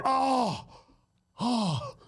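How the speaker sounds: ordinary delivery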